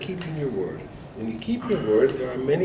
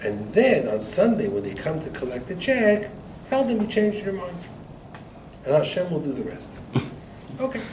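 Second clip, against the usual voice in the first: ordinary talk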